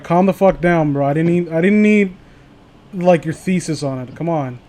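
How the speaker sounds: normal talking voice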